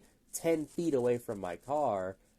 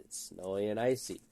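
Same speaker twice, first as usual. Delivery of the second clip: ordinary delivery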